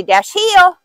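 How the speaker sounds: sharp high command to a dog; firm dog commands. Acted. Handler commanding dog to heel.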